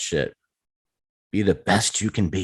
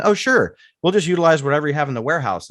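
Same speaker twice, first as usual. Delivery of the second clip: put-on eager kid; 6 semitones higher, faster than usual. Kids eagerly accepting warehouse offer.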